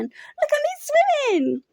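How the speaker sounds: high, loud little squeak; squeaky Roo. A narrator's reading. Little Roo shows off his swimming, proud squeak. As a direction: squeal it with pride like a tiny joey.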